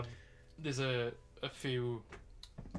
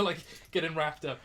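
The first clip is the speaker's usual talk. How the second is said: normal talking voice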